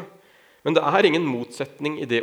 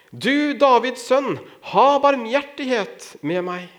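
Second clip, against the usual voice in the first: loud, high, stretched-out plea; insistent crying out; 10 semitones higher, 4 dB louder, slower than usual. A narrator's reading. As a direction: call out urgently for mercy, slow and raised. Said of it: "han ropte bare enda høyere". (høyt, inntrengende rop, langtrukkent)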